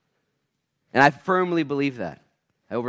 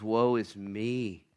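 humbled prophet; 2 semitones lower, 5 dB quieter, much slower than usual. Acted. Isaiah falling down before the Lord, humbled lament. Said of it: "He's humbled".

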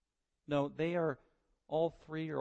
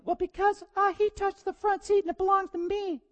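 put-on much higher whiny child voice; child excuse; 7 dB louder than usual. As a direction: whine the excuse like a little kid. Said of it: "why are you spitting on Sally".

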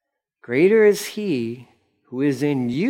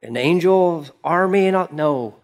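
ordinary talk, nothing marked

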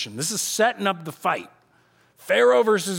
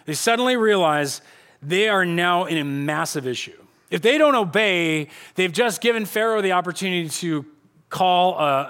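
normal talking voice

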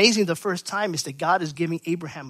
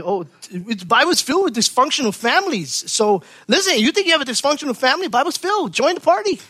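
higher, fast, teasing joke; joking aside; 6 semitones higher than usual. Real, not acted. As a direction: tease the crowd with a wry aside. Joking aside about dysfunctional families.

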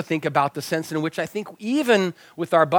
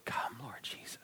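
sighed whisper, much softer; tired elder whispering a prayer; under his breath; 5 semitones lower, 20 dB quieter than usual. Retold or imitated. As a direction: breathe it out as a weary sigh. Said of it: "sigh quietly under his breath".

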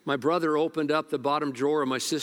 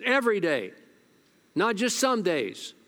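angry; 6 semitones higher than usual. Retold or imitated. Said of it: "He was angry".

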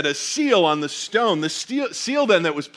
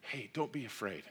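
soft, gentle hush, much quieter; calm the frightened women softly; hushed reassurance; 7 semitones lower, 18 dB quieter than usual. Retold or imitated. The angel comforts the women, soft hushed reassurance. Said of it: "says to the women".

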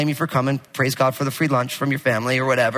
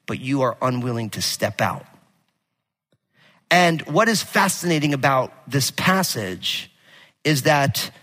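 ordinary talk

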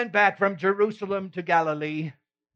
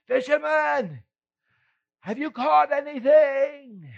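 calling from shore; 10 semitones higher, 4 dB louder than usual. Acted.

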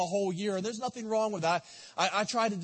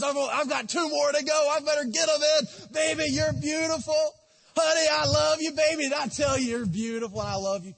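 higher, louder, dutiful husband getting it done; hurried checklist compliments; 6 semitones higher, 4 dB louder than usual. Acted. Husband rushing compliments to his wife before bed, mock eager. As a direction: rattle off the sweet nothings fast and loud, just to tick them off.

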